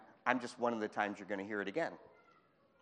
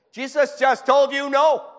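much higher, louder and slower, hammering it home; emphatic shout; 13 semitones higher, 15 dB louder than usual. Real, not acted. Preacher shouting to the congregation in disbelief, slow emphatic shout.

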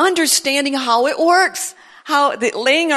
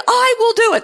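"I will do it." said much higher and louder with a laugh; mock-grand voice of God, amused; playful higher take; 11 semitones higher, 7 dB louder, faster than usual. Shown as a demonstration. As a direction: toss "I will do it." up high, laughing. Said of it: "Did you like that?".

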